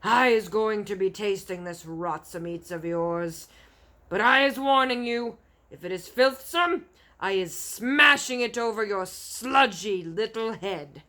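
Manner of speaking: bully him with every word; threatening giant. Acted. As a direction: Bloodbottler warning the BFG, loud and menacing.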